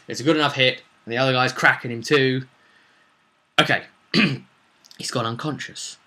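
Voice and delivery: ordinary delivery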